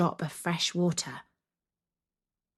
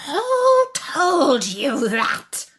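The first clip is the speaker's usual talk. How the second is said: higher, louder, slow furious demand; fierce demand; 8 semitones higher, 7 dB louder, much slower than usual. Acted. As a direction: demand it fiercely, each word heavy. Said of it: "she demanded so fiercely".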